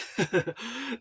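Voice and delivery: sudden high burst of laughter; a quick, high laugh at his own joke. Real, not acted.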